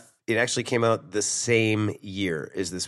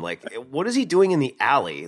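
ordinary talk, nothing marked

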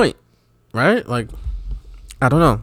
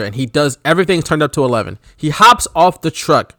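usual speaking voice

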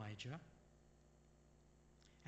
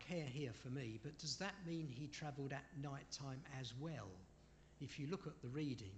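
normal talking voice

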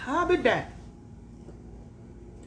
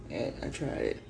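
ordinary talk, nothing marked

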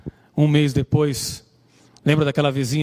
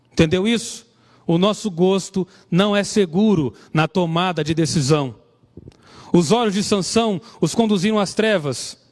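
put-on raised-pitch sermon delivery to young listeners; preaching; 4 semitones higher than usual. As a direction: preach the lesson, pressing each point home. Preaching the lessons of Samson.

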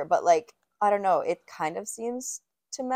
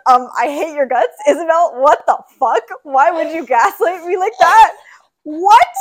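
loud, high, laughing indignation; mock fury; 7 semitones higher, 13 dB louder than usual. Real, not acted. Cohost yelling at Isabel for the hoax, mock fury. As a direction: yell at the prankster, half laughing. Mock rage at being fooled.

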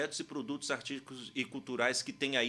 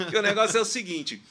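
talking through a laugh, high and loud; 9 semitones higher, 10 dB louder, faster than usual. Real, not acted. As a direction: say it still laughing. Laughing at his own age joke.

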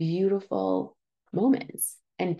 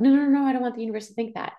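frantic backtrack; 4 semitones higher, 4 dB louder, much faster than usual. Retold or imitated. Hasty mock backpedal.

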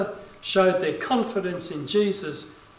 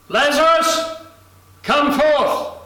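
high, booming call to raise the dead; full-voiced preacher's shout; loud command; 8 semitones higher, 6 dB louder, slower than usual. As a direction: cry it out so all those around hear. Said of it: "Jesus spoke loudly".

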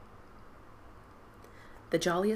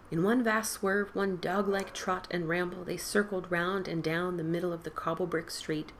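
ordinary delivery, nothing marked

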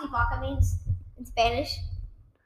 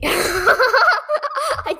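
laughing; 10 semitones higher than usual. Real, not acted. Laughing at own burp.

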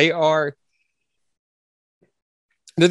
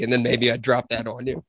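ordinary delivery

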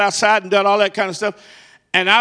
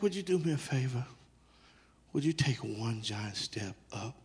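soft guiding; 9 semitones lower, 19 dB quieter than usual. Real, not acted. Guiding blindfolded volunteer softly.

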